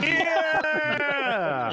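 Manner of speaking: high excited whoop; cheering shout. Real, not acted. Friend cheers unboxing.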